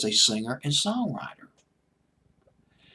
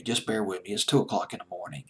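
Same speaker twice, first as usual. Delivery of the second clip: normal talking voice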